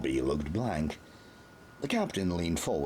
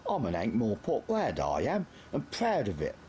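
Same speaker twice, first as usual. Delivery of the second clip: put-on higher, cocky city-boy voice; proud street lad; 7 semitones higher than usual. A narrator's reading. Nobby tells the Major he's an Ankh-Morpork lad, proud. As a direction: boast your home town. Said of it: "Nobby shouted".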